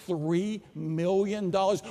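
each word drawn out, rising at the end; slow, awed repeat. Retold or imitated. Professor echoing the huge campaign budget, slowly and incredulously. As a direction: stretch the figure out in disbelief.

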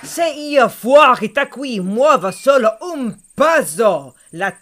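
loud, slow, snarling fox threat; menacing threat. Acted. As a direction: bellow at the intruder to scare him off. Fox threatening from inside the house.

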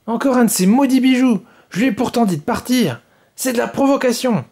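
high furious tantrum. Acted. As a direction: throw a royal fit over the gift. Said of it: "dit la reine qui piqua une colère".

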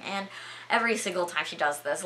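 usual speaking voice

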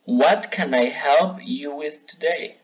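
slow, careful model sentence for learners; slow model phrase. Shown as a demonstration. Teacher modelling a phrase for students, said slowly. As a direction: spell it out slowly so students can copy. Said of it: "Aquí hay algunos ejemplos".

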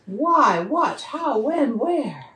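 high, loud string of question words; insistent child's questions; rapid-fire questioning. A narrator's reading. Child's barrage of questions voiced.

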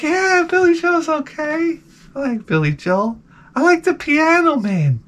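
high, strained agreeable voice; forced politeness. Retold or imitated. Guest pretending to like [name], falsely agreeable. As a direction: agree sweetly through the pain.

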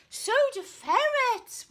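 high, loud whoop; whooping ferret. Acted. Ferret cheering with the knight, loud whoop. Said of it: "whooped Ferret".

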